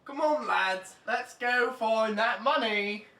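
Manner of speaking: loud, slow call to the gang; rallying call. Acted. King calling his lads to the money, loud. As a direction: rouse the lads like a crooked king.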